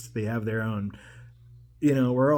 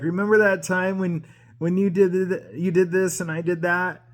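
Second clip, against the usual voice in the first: put-on higher-pitched, eager retelling to an old friend; lightly excited trip down memory lane; eager reminiscing; 5 semitones higher than usual.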